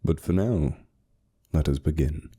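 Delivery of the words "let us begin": plain reading voice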